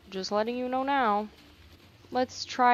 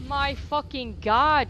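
ordinary talk, nothing marked

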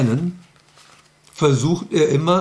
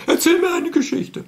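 very high whining puppet voice, near tears; a squeaky little creature protesting bedtime; Elfriede's squeaky voice. Acted. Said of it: "Das ist bei Elfriede genauso".

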